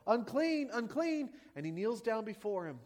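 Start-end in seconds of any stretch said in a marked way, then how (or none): 0.1-1.3 high, loud cry of unclean; leper calling out. Retold or imitated. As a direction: warn the crowd as you approach. Leper approaching crowd, calling.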